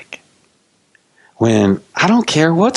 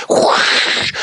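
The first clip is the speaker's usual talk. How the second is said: imitated rushing wind whoosh; storm whoosh. Preacher sounding the big storm's arrival, whooshing. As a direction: blow a sudden gust through the mouth.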